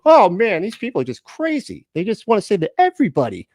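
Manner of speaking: hammed-up scornful voice waving critics away; mocking dismissive quote. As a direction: scoff at them like they're overreacting. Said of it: "And they're like".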